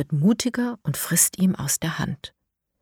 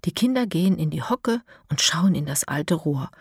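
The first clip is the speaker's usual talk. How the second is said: ordinary delivery, nothing marked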